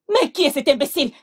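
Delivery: ordinary talk, nothing marked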